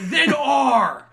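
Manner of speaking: yelled; shouting. Retold or imitated. Angry boss yelling at employee.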